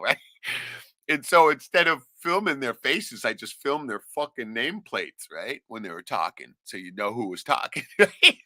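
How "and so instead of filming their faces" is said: usual speaking voice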